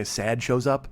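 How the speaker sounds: ordinary talk, nothing marked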